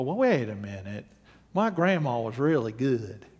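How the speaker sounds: low, soft Southern voice; mock objector with a low Southern twang; Southern accent. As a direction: protest in a quiet Southern accent. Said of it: "I go into Southern accent".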